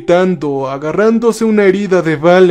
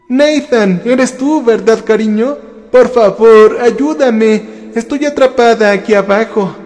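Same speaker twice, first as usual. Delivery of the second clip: higher, imploring mother's voice; pleading female voice; 5 semitones higher than usual. A narrator's reading. Trapped mother calls to her son from below, pleading female voice. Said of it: "una voz femenina que resonó".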